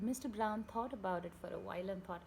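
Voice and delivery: ordinary delivery, nothing marked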